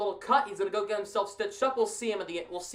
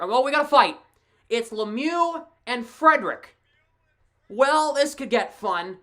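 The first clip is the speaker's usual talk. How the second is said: loud, higher, urgent live hockey call; excited fight play-by-play; 5 semitones higher, 6 dB louder than usual. Real, not acted. Commentator calling Lemieux and Frederic's fight, excited loud play-by-play. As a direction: punch out each blow as it lands.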